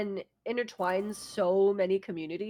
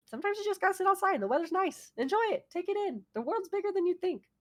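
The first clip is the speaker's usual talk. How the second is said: higher, airy mellow life advice; dreamy chill wisdom, lightly voiced; calm mellow guru; 10 semitones higher than usual. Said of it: "that was so green dog of you to say".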